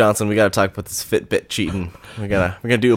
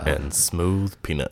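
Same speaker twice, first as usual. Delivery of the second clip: mock deep host; 6 semitones lower, 8 dB quieter, slower than usual. Joins the mock jazz podcast intro.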